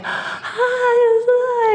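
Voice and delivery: very high, drawn-out squealed greeting; eager little puppy. Retold or imitated. Grateful stranded driver greets rescuer, eager puppy voice. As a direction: greet like an overexcited puppy, hammed up. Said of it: "I'm like a little puppy".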